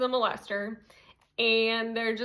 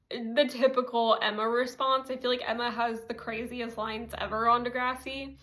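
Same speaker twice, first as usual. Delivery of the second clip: ordinary talk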